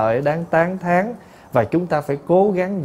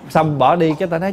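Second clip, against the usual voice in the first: ordinary talk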